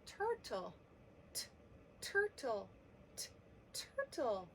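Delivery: crisp T alone, then the whole word turtle; first sound isolated. Shown as a demonstration. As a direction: sound out the T before saying turtle. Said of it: "sounds like he starts with the letter T".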